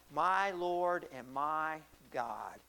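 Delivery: hushed-paced, deliberate acknowledgement of God; reverent confession. Retold or imitated. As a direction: let each word stretch out solemnly. Retells Thomas's awed confession.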